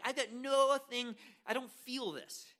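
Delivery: drawn-out mock reluctance; reluctant protest. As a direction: play someone who just isn't into it. Acted reluctant man facing the flower.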